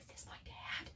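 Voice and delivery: a hushed, wondering question; awed whisper. Retold or imitated. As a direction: whisper it in amazement. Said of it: "Shiva's like".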